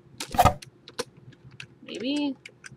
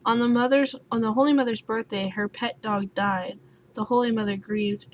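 ordinary delivery

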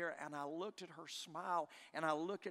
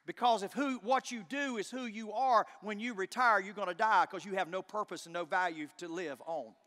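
louder rising sermon drive; preaching at full force; 5 semitones higher, 8 dB louder than usual. Real, not acted. Preacher urging listeners about purpose, preaching at full force. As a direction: preach it home with power.

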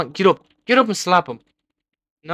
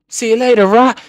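loud, higher send-off; gloating shout; 7 semitones higher, 7 dB louder than usual. Real, not acted. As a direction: crow it at a beaten rival. Taunts player he slapped off.

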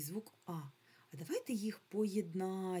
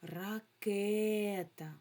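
Ракета slow, loud, with the KE syllable stressed; 3 semitones higher, 4 dB louder, much slower than usual. Shown as a demonstration. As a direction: stretch Ракета and lean on the Е. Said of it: "Наголос ставимо на Е". (наголос на Е, повільно)

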